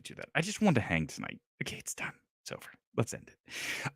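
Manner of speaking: whispered, done with it; muttered to self. Real, not acted. Host regretting the rant, whispering to himself. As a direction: mutter it under your breath. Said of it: "I didn't wanna do that".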